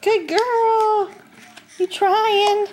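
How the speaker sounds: high cooing praise. Real, not acted. Praising baby's crawling attempts.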